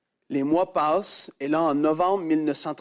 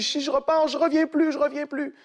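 put-on fearful boy; 11 semitones higher than usual. Boy fears not returning.

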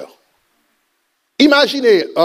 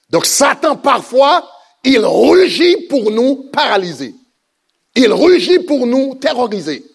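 higher, rising preacher's cry over music; full-force preaching; 4 semitones higher than usual. Acted. Preacher rousing the church against fear, full preaching force. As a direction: build the congregation up, voice climbing.

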